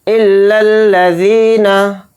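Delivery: इल्लल्लज़ीना with held double ll and stretched ज़ी; इल्लल्लज़ीना chanted slowly; doubled l's and long ee. Shown as a demonstration. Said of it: "लाम को लाम में मिलाएंगे".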